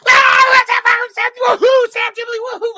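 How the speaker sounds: whooping shout, high and loud; rowdy cheering. Retold or imitated. Heckling fan cheering the host from the crowd, whooping shout. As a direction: holler at the stage like a wild fan. Cheering Sam at comedy show.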